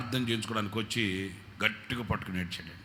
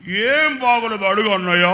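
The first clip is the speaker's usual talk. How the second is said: loud, higher indignant jab; sharp retort; 8 semitones higher, 11 dB louder than usual. Retold or imitated. Wife cutting in at her husband, loud and indignant. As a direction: throw the question back at him.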